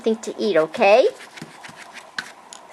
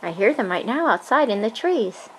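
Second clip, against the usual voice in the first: usual speaking voice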